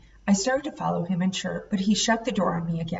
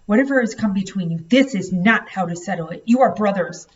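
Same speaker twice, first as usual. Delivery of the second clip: plain reading voice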